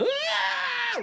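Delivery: high-pitched reenacted scream of pain; retold scream. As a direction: re-enact running off screaming from wasp stings.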